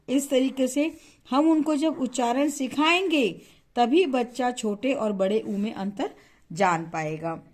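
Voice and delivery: ordinary talk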